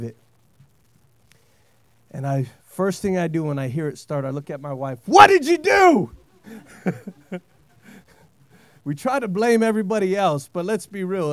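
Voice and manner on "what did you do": loud, high accusing question. Retold or imitated. Husband blaming his wife when the truck knocks, loud mock accusation. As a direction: hit WHAT hard, accuse her playfully.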